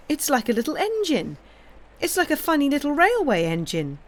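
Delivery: high, delighted squeal. A narrator's reading. Isabel squealing at the running duck, high and delighted. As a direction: squeal it like a thrilled little girl. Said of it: "squealed Isabel".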